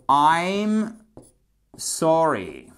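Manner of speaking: drawn-out, careful English; slow teaching model. Shown as a demonstration. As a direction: dictate it word by word. Teacher models phrase slowly.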